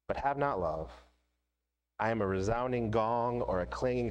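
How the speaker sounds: scripture reading. Acted. Pastor reading First Corinthians to the congregation, solemn. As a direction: read it solemnly, letting pauses land.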